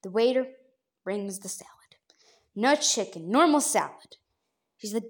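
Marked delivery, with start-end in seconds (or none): 1.0-1.7 whisper. Real, not acted. Whispered aside.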